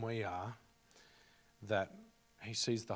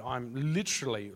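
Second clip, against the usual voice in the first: ordinary delivery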